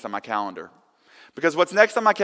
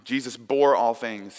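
ordinary delivery

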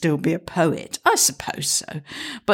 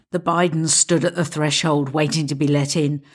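usual speaking voice

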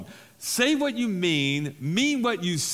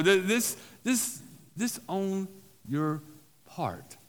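usual speaking voice

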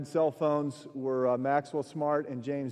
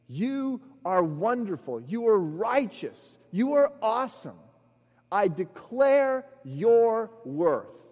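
prayerful declaration; 4 semitones higher than usual. Shown as a demonstration. Preacher voicing the declaration people think they pray, rising praise. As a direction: proclaim God's worth, rising. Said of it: "In other words".

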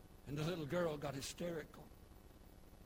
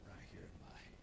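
hushed whisper, much softer; 10 semitones lower, 10 dB quieter than usual. Acted. Father to the frightened girl, whispered. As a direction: breathe it like a secret.

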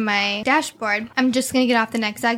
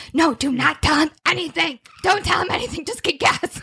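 higher, urgent begging; higher-pitched frantic plea to her brother; panicked pleading; 5 semitones higher than usual. Retold or imitated. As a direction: beg him not to tell, pitched up. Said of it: "I'm like".

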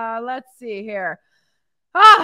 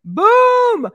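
excited boom; 9 semitones higher, 8 dB louder than usual. Real, not acted. Celebrating a client's win.

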